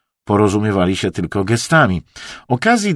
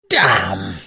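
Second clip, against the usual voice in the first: mild, frustrated curse under narration; short vexed oath; hissed curse. A narrator's reading. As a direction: curse at a spoiled drawing, held in check. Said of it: "Syknął na widok zepsutego rysunku".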